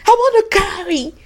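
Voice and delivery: pleading prayer pushed up high. Pretend. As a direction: pitch "I want to carry" up, begging. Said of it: "start right now and say".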